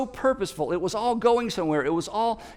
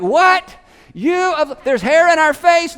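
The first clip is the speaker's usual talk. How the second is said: loud, high yelling, can't hear a word; confused shout; 9 semitones higher, 8 dB louder, slower than usual. Retold or imitated. Disciples shout back, confused, over the storm. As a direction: yell back mishearing over the roar. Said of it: "they're like".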